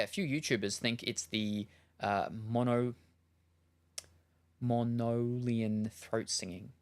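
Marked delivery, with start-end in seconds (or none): 2.3-5.9 Monolian drawn out slowly; slow sounding-out. Real, not acted. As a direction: feel your way through the word Monolian. Sounding out an unfamiliar word.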